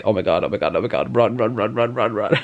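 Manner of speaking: mock panic. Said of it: "I'm like".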